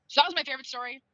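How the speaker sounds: usual speaking voice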